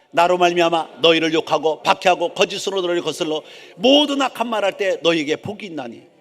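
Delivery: loud, measured Bible recitation; projected congregational reading; scripture reading. Real, not acted. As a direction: read the verse out for all to follow. Reads scripture aloud with congregation.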